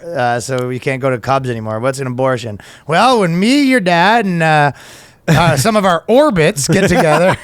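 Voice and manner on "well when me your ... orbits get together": high, loud, hesitant explainer voice; hammed-up awkward talk to a kid; parent explaining. Parent explaining abortion to a child, hammed-up awkwardness.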